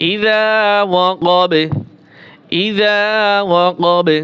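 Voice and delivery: ইযা ওয়াকাবে with long vowels and a short bouncing final b; qalqalah on b. Shown as a demonstration. Teacher showing qalqalah on ba, bounced final b. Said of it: "কলকলা হবে".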